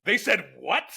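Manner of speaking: mock disbelief at what was heard; double take. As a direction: act out a stunned double take. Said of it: "you double take".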